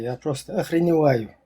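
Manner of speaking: ordinary talk, nothing marked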